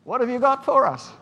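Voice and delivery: put-on high, loud excited child voice; eager kids. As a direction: shout it like greedy little kids. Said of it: "you know what they said?".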